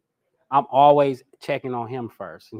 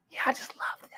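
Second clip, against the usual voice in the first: dreamy whisper; 9 dB quieter than usual. Pretend. Mocking a smitten dove.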